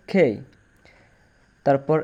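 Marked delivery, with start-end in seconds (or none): 0.1-0.3 "খেই" with an airy kh, then e gliding to i; falling "খেই" with aspirated k. Shown as a demonstration. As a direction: puff the first sound of "খেই". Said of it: "কে এর উচ্চারণ হবে খেই".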